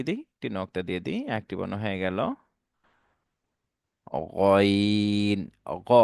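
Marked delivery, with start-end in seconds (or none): 4.1-5.5 গইন held very long, gargled in the throat; গড়গড়া করে গইন; correct ghain. Shown as a demonstration. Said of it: "অনেকে গাইন পড়ে, এটা ভুল হবে".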